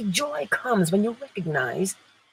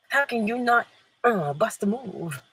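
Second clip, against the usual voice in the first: ordinary talk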